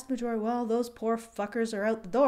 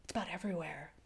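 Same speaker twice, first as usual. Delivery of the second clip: ominous hush; 9 semitones lower, 17 dB quieter, faster than usual. Pretend. Ominous hushed line.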